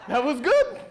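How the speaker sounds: loud, high, laughing admission; past-mad amusement. Retold or imitated. Applicant too mad to be mad, laughing at the jab. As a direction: concede the insult, cracking up. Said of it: "you're just not even mad anymore".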